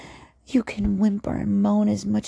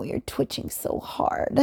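ordinary delivery

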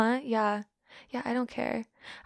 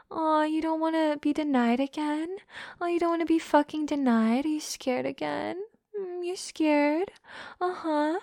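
higher cooing mock concern; mock sympathy; 6 semitones higher, 3 dB louder than usual. Teasing him about being scared, cooing mock pity. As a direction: coo with fake pity.

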